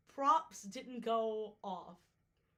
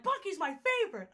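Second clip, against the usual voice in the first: put-on high, quick kid's gush; excited kid; 9 semitones higher, 7 dB louder, faster than usual. Kid telling the vlogger Bucky is his favorite, high and excited. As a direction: gush it like an eager little fan. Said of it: "the kid was like".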